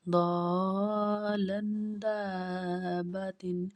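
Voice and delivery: very slow, stretched vowels; slow blended reading. Shown as a demonstration. Teacher blending letters for a pupil, slow and stretched. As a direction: draw each sound out for the pupil. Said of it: "teacher blends spelled letters".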